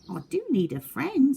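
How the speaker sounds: high lonely little voice; a small sad animal admitting it; otter voice. Acted. Otter telling the beavers it needs a friend, high small voice.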